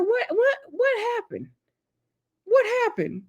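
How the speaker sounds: high, put-on bewildered voice; hammed-up confused viewer; mock confused. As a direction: squeak it out, baffled. Her own mock confusion.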